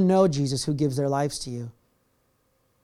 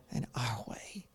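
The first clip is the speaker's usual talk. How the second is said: sudden hushed whisper; 7 semitones lower, 16 dB quieter, slower than usual. Acted. Ending God's plea to listeners in a whisper. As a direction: drop it to a breath.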